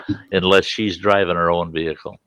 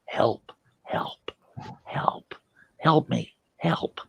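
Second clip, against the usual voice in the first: whispered cry for help; barely voiced, urgent little pleas spaced apart; mouthed plea; 7 semitones higher, 8 dB quieter, much slower than usual. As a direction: mime a silent help with just breath. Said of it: "she'll keep mouthing".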